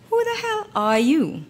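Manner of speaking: high, sharp demand to know who he is. A narrator's reading. Model confronting a rude stranger, high indignant demand. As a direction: challenge him, offended. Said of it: "I demanded".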